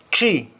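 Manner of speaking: "tree" said with a plain t, no th sound; Jamaican tree. Shown as a demonstration. Teacher showing the Jamaican "tree" for three, hard t. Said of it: "we say three".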